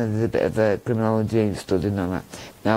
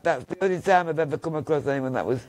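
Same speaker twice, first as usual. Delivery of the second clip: usual speaking voice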